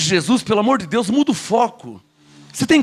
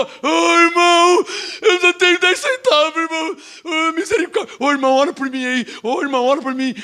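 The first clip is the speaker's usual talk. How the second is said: high, wailing beggar's whine; hammed-up plea for sympathy; mock pleading; 5 semitones higher than usual. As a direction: imitate a pitiful believer begging prayer. Mocking himself begging for prayer.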